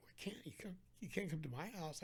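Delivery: hushed, urgent refusal on the phone; retold whisper. Retelling how he whispered to the girl. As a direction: whisper it, turning her down.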